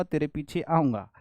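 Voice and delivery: ordinary delivery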